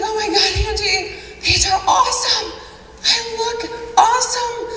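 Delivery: high-pitched gushing; thrilled customer. Retold or imitated. Customer showing off her jeans to the seller, thrilled gushing. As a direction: gush in a high, delighted voice.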